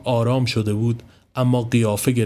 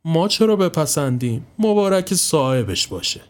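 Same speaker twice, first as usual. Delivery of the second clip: higher, drawn-out sniffy retort; frowning; 6 semitones higher than usual. A narrator's reading. Mehri answers that it is the owner's business, frowning. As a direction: say it slowly with a frown in the voice. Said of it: "اخم کنان گفت".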